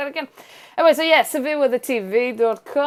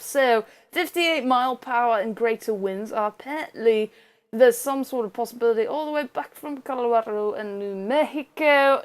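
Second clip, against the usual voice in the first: ordinary talk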